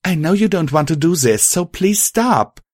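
half-yelled appeal; pleading yell. A narrator's reading. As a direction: call out pleadingly, pitch up. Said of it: "Violet yelled".

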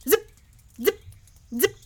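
short, separated arrow noises; zip, pause, zip, like arrows flying; imitated arrow zips. A narrator's reading. Narrator sounding out a volley of arrows, sharp zips.

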